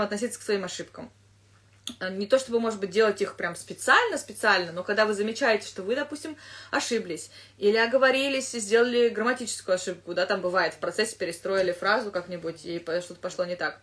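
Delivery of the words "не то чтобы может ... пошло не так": usual speaking voice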